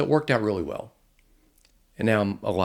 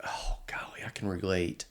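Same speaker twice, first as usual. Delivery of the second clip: near-whispered, much softer imagined reaction; hushed admission; 7 dB quieter than usual. Retold or imitated. Audience member privately relating to his story, hushed. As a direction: quietly imitate someone owning up under their breath.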